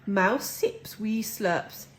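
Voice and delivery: usual speaking voice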